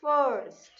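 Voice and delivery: 'First' said loudly with a clear R; R sounded in 'First'. Shown as a demonstration. Teacher models the word for children, 'First' with its R. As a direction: make the R heard in 'First'. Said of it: "So R sound has to be there".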